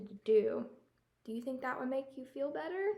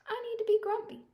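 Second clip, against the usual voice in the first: much higher, quick and firm; high, fast insistence; 11 semitones higher, 4 dB louder, faster than usual. Acted. Jim tells Norman he needs to stay grumpy, high and quick. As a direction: rush it out, high and set.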